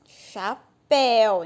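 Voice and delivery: "chapéu" drawn out as cha, then péu; loud, stretched second syllable in "chapéu"; two syllables. Shown as a demonstration. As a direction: say "chapéu" slowly in two beats, leaning on "péu". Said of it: "Então tem duas sílabas".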